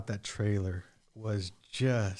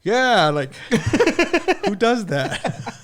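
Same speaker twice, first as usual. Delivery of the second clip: high laughing mock agreement, slow at the end; giggling along, pitched high and loud; 12 semitones higher, 10 dB louder, much slower than usual. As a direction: laugh through a fake yes-man reply.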